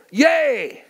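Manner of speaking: high whooping yay; sports fan cheer. Retold or imitated. Fan cheering a buzzer beater, high mock whoop. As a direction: cheer like your team just won. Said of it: "and you go".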